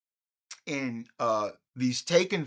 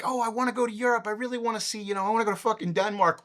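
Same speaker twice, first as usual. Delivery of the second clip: eager tourist; 5 semitones higher, much faster than usual. Retold or imitated. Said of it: "People are like".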